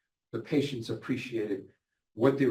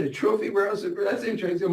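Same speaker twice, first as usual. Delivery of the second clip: higher, fast, amused disbelief; surprised echo; 7 semitones higher, faster than usual. Retold or imitated. Nurse echoing the patient's job title, surprised. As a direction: echo it back, surprised and quick. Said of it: "I said".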